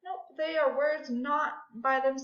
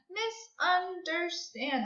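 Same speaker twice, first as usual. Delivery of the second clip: "Misunderstand" higher and slow in four beats; counted syllables; 5 semitones higher, slower than usual. Shown as a demonstration. Teacher counting four syllables for pupils, evenly split. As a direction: step through "Misunderstand" syllable by syllable. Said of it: "That's four syllables".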